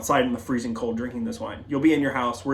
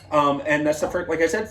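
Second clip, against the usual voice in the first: usual speaking voice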